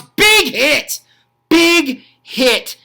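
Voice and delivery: high, loud repeated call; excited shout. Real, not acted. Commentator reacting to a bone-crushing tackle, excited shout. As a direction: punch it out like a crowd-rousing call. Calling a huge hit on replay.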